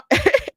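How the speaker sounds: short high giggle; a quick laugh up high. Real, not acted. Laughing at Shapiro's calm reply.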